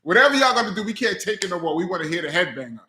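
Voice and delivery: mock crowd begging, quick; impatient crowd. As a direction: rush it out like fans who can't wait. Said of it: "they just like".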